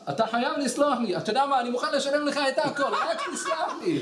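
desperate pleading. Retold or imitated. Acted desperate plea for forgiveness.

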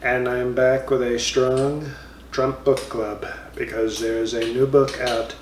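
normal talking voice

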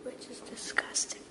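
whispering. Real, not acted. Whispering in the gallery.

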